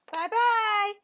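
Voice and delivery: Mama Bear calls goodbye in a middle-sized voice. A narrator's reading. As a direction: call it like a mother bear. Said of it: "a middle-sized voice".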